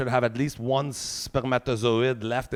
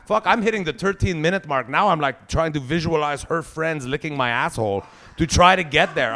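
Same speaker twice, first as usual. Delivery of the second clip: normal talking voice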